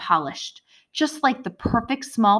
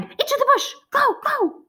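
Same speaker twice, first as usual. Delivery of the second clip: high, urgent bird-creature cry. Acted. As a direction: hurry them into hiding. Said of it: "Scorp cried".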